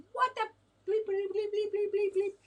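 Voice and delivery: high-pitched mock daughters cursing; daughters' outburst. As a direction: imitate shocked daughters with censor bleeps in a high voice. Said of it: "I've heard some daughters come out like".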